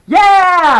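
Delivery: very high, loud whoop; delighted cheer. A narrator's reading. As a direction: cheer like a kid who just won. Girl cheers getting her choice.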